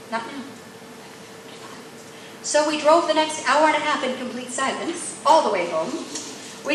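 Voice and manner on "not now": soft, gentle check; hushed restraint. Retold or imitated. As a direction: quietly voice God holding her back. God restrains her before she unloads.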